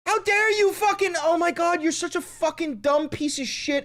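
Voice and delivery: high furious yelling rant; angry crying. Real, not acted. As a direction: rage at him, voice cracking high. Raging at Trump's post.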